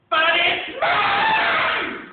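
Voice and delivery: very high, loud, slow drawn-out scream. Acted. Maisie claims the egg from Horton, drawn-out scream. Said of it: "screamed the bird".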